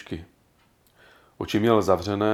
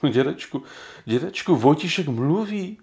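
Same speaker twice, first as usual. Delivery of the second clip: excited whisper; 7 semitones higher than usual. A narrator's reading. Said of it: "šeptal Jiřík rozechvěle".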